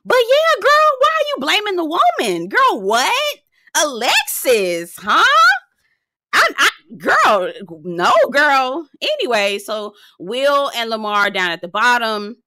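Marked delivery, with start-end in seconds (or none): 0.0-8.9 way up high, indignant and incredulous. Real, not acted. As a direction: shriek it at her in disbelief. Indignant address to Alexis.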